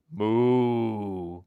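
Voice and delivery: low drawn-out moo; cow moo. A narrator's reading. Eloise the cow says hello to the puppies with a low moo.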